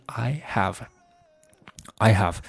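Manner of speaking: "I have" slow, the h clearly sounded; soft, careful "I have" without linking; clear, unlinked. Shown as a demonstration. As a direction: keep I and HAVE separate in "I have". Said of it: "还是比较清晰的".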